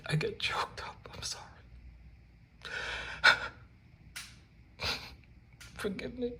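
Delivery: high, breathy fake sob through the words; mock choked-up crying. Paper hanger fake-cries to viewers, hammed-up choked whisper. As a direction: ham up tearful gratitude over his own work. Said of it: "I was kidding about the crying".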